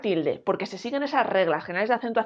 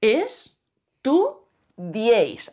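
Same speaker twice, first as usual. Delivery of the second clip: "Es, tu, diéis" in three separate beats; slowly sounded out "Es... tu... diéis"; syllable by syllable; much slower than usual. Shown as a demonstration. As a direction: break the word into "Es", "tu", "diéis" with clear pauses. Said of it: "Separamos esta palabra en sílabas".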